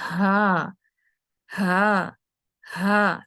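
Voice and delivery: "ہا" pushed from the chest with open throat; sustained "ہا" on open, airy onset; open vocal cords. Shown as a demonstration. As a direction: breathe each "ہا" out long and separately. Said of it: "کھلے vocal cord سے آواز پیدا کرنی ہے".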